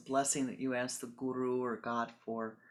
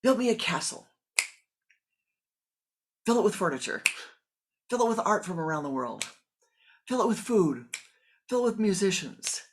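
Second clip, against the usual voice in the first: loud, higher barked orders; commanding shout; 6 semitones higher, 11 dB louder than usual. Acted. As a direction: command the demon at full voice. Disciple orders the demon loudly.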